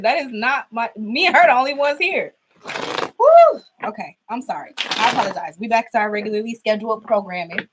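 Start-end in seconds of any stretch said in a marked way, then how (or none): 3.2-3.6 high whoo. Real, not acted. Shaking off the fright.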